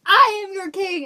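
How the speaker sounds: loud, high royal outburst; yelling. Retold or imitated. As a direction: yell it out as an enraged king, hammed-up. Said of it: "yelling solves nothing here".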